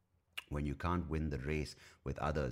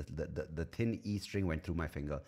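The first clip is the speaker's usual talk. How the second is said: ordinary delivery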